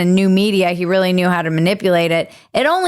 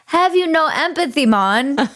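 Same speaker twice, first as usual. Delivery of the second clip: higher-pitched, badly faked Jamaican lilt; hammy fake Jamaican accent; mock Jamaican accent; 6 semitones higher than usual. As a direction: imitate a politician's put-on Jamaican accent. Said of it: "she was trying to be Jamaican or something".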